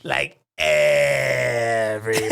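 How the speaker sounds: slow loud wailing emphasis; emphatic shout. Pretend. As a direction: stretch and yell everybody. Emphatic comic cry.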